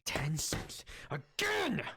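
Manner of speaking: high, slow indignant yell, rising on Again; angry shout. Acted. Lizard folk yelling at a demon over change, high and furious. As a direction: rant like a cheated customer. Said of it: "he's like".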